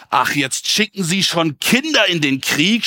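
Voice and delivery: put-on mildly scornful sneer at a young opponent; taunting, a touch of disbelief; mocking. A narrator's reading. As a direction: read it with light, lilting mockery. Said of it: "spottete er".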